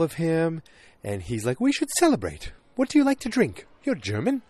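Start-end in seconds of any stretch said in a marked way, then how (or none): 1.6-4.4 high, hammed-up smarmy come-on; oily mock flirting in a high register; creepy salesperson. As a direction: play a creepy salesman pitching a drink, voice pushed way up. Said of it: "that Pete salesperson creepness".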